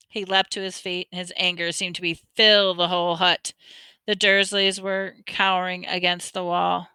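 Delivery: ordinary delivery, nothing marked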